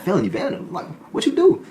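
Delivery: can't believe it's just vandalism; surprised. Retold or imitated. Inmate reacts to his charge, high surprised.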